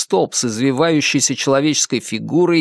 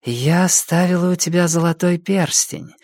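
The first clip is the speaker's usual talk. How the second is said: put-on a lightly higher borrowed girl's voice from the old witch; witch in Patricia's voice; 5 semitones higher than usual. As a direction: let the hag speak sweetly in Patricia's voice. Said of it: "сказала старуха голосом Патриции".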